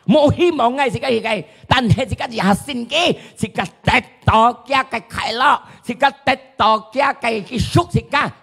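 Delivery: high-pitched, emphatic proclamation; fervent preaching. Acted. Preacher proclaiming redemption to those without hope, fervent.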